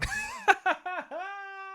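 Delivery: high, squeaky cackle; long high laugh trailing away; cackling laugh. Real, not acted. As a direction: break into helpless cackling. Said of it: "I just started cackling, laughing".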